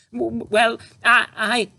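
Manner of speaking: high, loud stammering mother; lost for words, tripping over it; spluttering. A narrator's reading. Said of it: "spluttered Mother".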